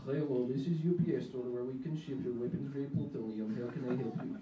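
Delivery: put-on Russian accent. Store clerk answering calls as a Russian weapons dealer, Russian accent. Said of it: "kind of a decent Russian accent".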